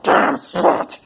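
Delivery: very high loud snap of rage; sudden mad shout; furious outburst. A narrator's reading. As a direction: explode on the words.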